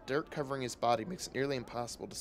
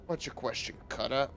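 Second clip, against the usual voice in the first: lightly voiced rat hawker. Rat seller answering.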